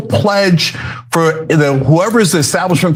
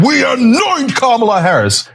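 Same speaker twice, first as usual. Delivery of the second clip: a higher, solemn mock proclamation; mock party decree; 10 semitones higher than usual. Mocking the party anointing Kamala, a higher mock decree. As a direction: announce it like a pompous decree. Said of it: "the Democratic Party said".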